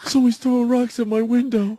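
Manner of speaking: high-pitched imitation of an alarmed woman; mock alarmed neighbor. Woman reporting rocks thrown at her window, mock alarmed.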